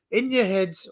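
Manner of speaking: ordinary talk, nothing marked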